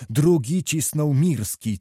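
ordinary delivery

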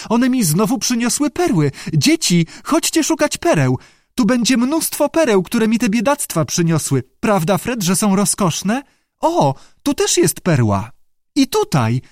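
high, delighted pearl-hunter; excited exclaiming. A narrator's reading. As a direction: call the others over in a high excited voice. Lee thrilled at finding pearls.

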